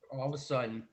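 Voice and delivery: normal talking voice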